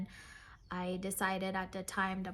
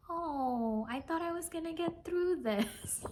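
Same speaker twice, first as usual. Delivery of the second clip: higher-pitched wistful quote; imitated reminiscing; 7 semitones higher than usual. Seller looking back at her stash, imitated wistfully. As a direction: lightly mimic a rueful "oh".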